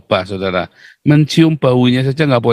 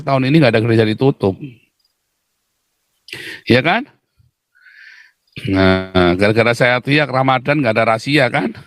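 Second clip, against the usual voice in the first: normal talking voice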